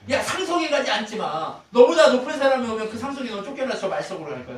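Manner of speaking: loud, high pulpit quote; acted-out scolding advice; acted warning. Preacher voices a warning from Proverbs.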